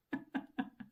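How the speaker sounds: breathless giggle off mic; laughing quietly to herself; a soft unvoiced laugh; real laugh. Real, not acted. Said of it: "I'm sorry, I'm laughing".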